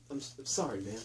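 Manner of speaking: flat mumbled apology. Retold or imitated. Jake apologizes to the widow, soft and offhand. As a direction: toss it away quietly.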